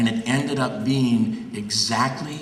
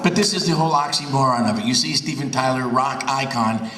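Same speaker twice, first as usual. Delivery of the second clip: ordinary talk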